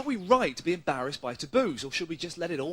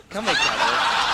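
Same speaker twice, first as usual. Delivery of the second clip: slow, louder, hammed-up come-on; mock flirt; 7 dB louder, slower than usual. Reporter teases the interviewee with a slow, hammed-up mock seduction. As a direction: drag it out like a cheesy seducer.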